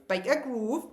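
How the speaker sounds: normal talking voice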